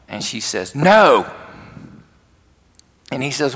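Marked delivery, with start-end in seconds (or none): 0.7-1.3 higher, louder retold "no" of an upset girl; acted-out teenage refusal; curt refusal. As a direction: shut him down, pitched up and loud.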